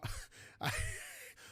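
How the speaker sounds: very high, breathless giggle; helpless laugh at his own bad accent. Real, not acted. Laughs at his horrible accent attempt.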